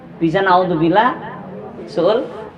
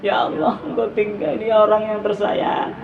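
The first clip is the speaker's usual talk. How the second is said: higher, softer imitated tearful prayer; scene: preacher imitating a grieving congregant praying, tearful; mock weeping prayer; 5 semitones higher, 3 dB quieter than usual. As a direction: play a woman crying to God over a lost loved one.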